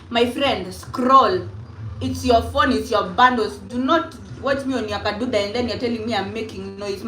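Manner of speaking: normal talking voice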